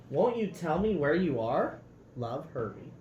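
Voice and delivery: lively, expressive storybook read-aloud; reading to children. A narrator's reading. Parent reading a picture book to children, lively storybook voice.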